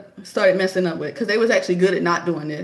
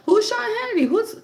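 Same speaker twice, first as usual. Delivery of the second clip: much higher, quick, puzzled asking; mock curious; 14 semitones higher, faster than usual. Asking who the Fox hosts are, hammed-up much higher puzzlement. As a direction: play up the baffled curiosity, up high. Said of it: "I'm like".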